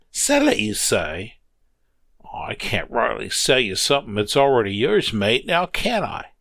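put-on old Joe gently refusing to sell; sly old railroader. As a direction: play coy with a wink. Joe voiced, teasing.

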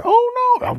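very high, loud squeal of panic; tiny fly's shriek. Acted. Fly grabbed by the spider, very high shriek. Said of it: "and then I'd hear".

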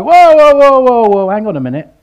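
high, loud startled cries of whoa. Retold or imitated. Jesus startled by men breaking through roof, loud high whoa. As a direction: pull up short in loud alarm. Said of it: "Does Jesus go".

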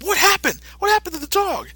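shocked alarm. Retold or imitated. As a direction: gasp it out in disbelief. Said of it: "Mister Satan has like".

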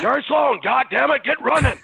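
high-pitched hollering coach; yelling coach impression. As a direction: bark orders at players, hammed up. Impersonates the shouting coach.

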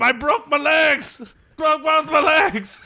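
high, slow hammed-up mascot whine; mascot moaning. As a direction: moan like the injured pickle. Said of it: "the pickle went".

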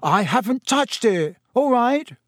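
high-pitched indignant retort; roaring back. A narrator's reading. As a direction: fling the denial back, only moderately loud. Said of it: "Ron roared back".

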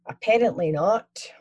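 slow whispered Glaswegian drawl; Glaswegian accent. Acted. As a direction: stretch the word out Glaswegian-style. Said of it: "his Glaswegian accent".

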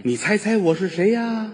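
put-on a light sing-song for a child on the phone; lightly voiced doting dad on the phone; talking to a child. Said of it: "给儿子打电话".

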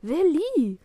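loud, high yell of the name; angry bellow. A narrator's reading. Papa bellows from the kitchen, angry. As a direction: roar for the child, really cross. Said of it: "brüllt Papa aus der Küche".

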